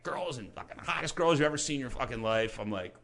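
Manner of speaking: ordinary delivery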